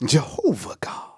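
breathy hush, almost no voice; hushed whisper. Real, not acted. Preacher to congregation, whispering God's name. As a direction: whisper the name of God reverently.

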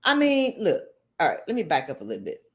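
ordinary delivery

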